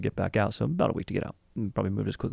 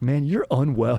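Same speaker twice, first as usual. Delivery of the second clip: mock-upset host, higher and louder; Steve Harvey; 6 semitones higher, 5 dB louder than usual. Host to rowdy guest who smashed his bust, mock upset. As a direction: imitate an affronted TV host. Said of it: "Steve Harvey is visibly upset".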